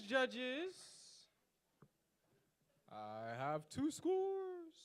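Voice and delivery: normal talking voice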